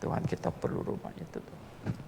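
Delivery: hushed, private plea to God; whispered prayer. Retold or imitated. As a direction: whisper the prayer under your breath. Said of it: "saya berdoa".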